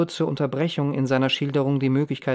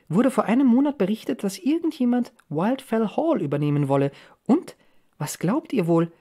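put-on higher, lilting young woman's voice; eager sister Rose; 6 semitones higher than usual. A narrator's reading. Rose breaks important news to the family, eager. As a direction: build up the big news with excited lifts.

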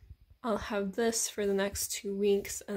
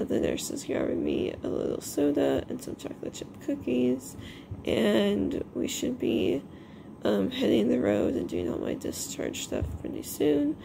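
groggy after anesthesia; 2 semitones higher than usual. Real, not acted. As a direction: still groggy from anesthesia, words dragging. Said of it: "It took me a long time to really shake off the drowsiness".

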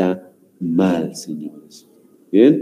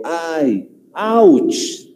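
drawn-out "ay", then high "ouch"; mock pain; 6 semitones higher than usual. Shown as a demonstration. Teacher showing how pain is emphasized, acted hurt exclamations. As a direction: yelp as if hurt, for demonstration. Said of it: "cuando quiero enfatizar lo que es un dolor".

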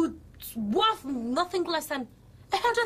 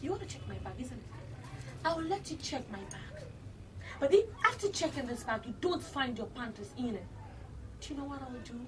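cold quiet threat; 3 semitones lower, 10 dB quieter than usual. Acted. Daughter quietly threatens boutique staff, cold menace. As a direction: threaten them under your breath.

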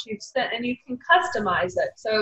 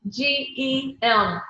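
slow, higher, letter by letter; spelled out; 4 semitones higher, much slower than usual. Real, not acted. Speaker spells the product name for a confused colleague, slow and higher. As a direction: spell it out slowly to clear up confusion.

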